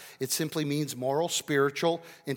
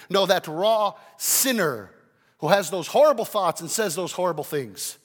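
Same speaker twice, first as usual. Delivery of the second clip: loud, forceful sermon; impassioned preaching; 5 semitones higher, 9 dB louder than usual. Acted. Preacher tells congregation Christ died for raw sinners, full-force preaching. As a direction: hammer it home at full volume.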